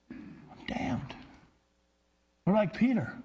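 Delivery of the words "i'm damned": terrified whisper. Retold or imitated. Preacher voices Isaiah whispering his doom. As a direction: whisper it, horror-struck.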